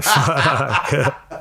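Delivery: a laughing, disbelieving "Fuck"; laughing curse. Retold or imitated. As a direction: laugh through the word at the absurd sight. Four bodybuilders at the vet.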